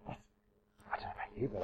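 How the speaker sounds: hushed, breathy confiding whisper; a secret just for the audience; whispered aside. Real, not acted. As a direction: lean in and whisper it to the room.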